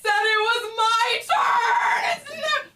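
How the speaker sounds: high, drawn-out shrieking wail; loud, hammed-up crying scream; screaming, sobbing kid. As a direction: imitate a child's full-blown tantrum. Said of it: "their kid comes out screaming, sobbing".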